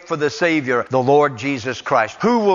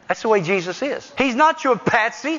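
rising, ringing pulpit cadence, each line pushed higher; preaching at full force; 10 semitones higher than usual. Acted. Preaching climax.

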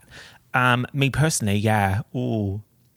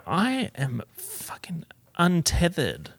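whisper; 4 semitones higher, slower than usual. Pretend. Whispered boast about being wireless.

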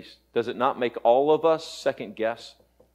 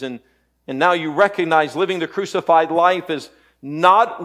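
raised, louder pulpit voice; impassioned preaching; 6 semitones higher, 7 dB louder than usual. Real, not acted. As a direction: preach it with force, pitched up. Preaching at full force.

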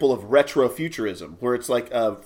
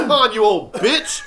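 mock outrage; 7 semitones higher, 5 dB louder than usual. End of the mock rant.

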